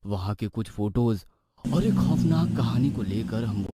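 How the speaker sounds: ordinary delivery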